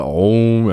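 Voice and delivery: long droning "om" rising into a held note; chanted oracle "om"; oracle's trance hum. Acted. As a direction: intone a drawn-out meditative hum. Oracle hums before answering.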